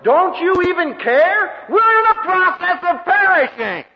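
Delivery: high, loud, slow desperate cry; hammed-up terrified plea to the Lord; panicked disciples. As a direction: plead like men about to drown. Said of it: "They say".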